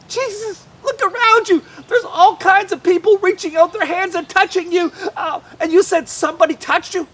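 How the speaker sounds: high and loud, incredulous; you can't be serious; exasperated disbelief. Acted. Disciples exasperated with Jesus.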